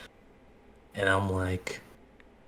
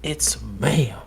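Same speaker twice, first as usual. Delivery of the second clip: whisper; 3 semitones higher than usual. Retold or imitated. Whispered correction.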